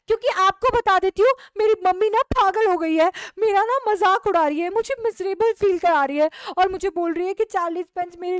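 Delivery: loud, high tearful complaint; upset outburst. Acted. Upset daughter loudly complains about her mother to the neighbour. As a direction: pour out the grievance loudly.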